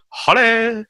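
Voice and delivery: high, posh phone greeting; dad's phone hello. Retold or imitated. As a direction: answer the phone all proper. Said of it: "My dad answers the phone like that".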